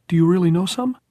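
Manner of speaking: put-on high, loud boyish question; hopeful boy. A narrator's reading. Jordan asking Tom for poetry, hopeful. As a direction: ask with eager hope, pitched right up.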